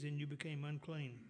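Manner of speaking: ordinary delivery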